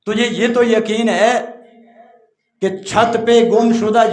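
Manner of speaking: high, loud sermon delivery; preaching at full force. Acted. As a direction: thunder the rich man's words to the hall. Preacher drives home lesson. (پوری قوت سے وعظ)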